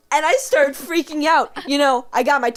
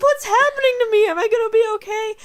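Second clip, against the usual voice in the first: very high, fast, crying panic; frightened child; 10 semitones higher, 5 dB louder, faster than usual. Retold or imitated. Young girl retelling her burned tongue, crying high panic. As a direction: wail it like a terrified little kid. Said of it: "and I'm like".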